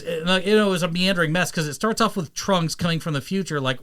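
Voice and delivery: usual speaking voice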